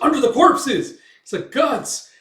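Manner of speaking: high, loud battlefield cry; acted soldier's alarm; alarmed shout. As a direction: yell out the sudden discovery.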